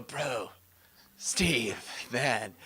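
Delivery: lightly voiced surfer dude; a hint of a laid-back surfer bro. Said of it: "talk like the surfer dude".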